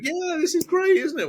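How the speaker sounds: very high, thrilled mock shout; gleeful driver. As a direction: whoop it high like a reckless driver having fun. Kev enjoying his reckless driving.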